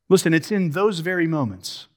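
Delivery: ordinary talk, nothing marked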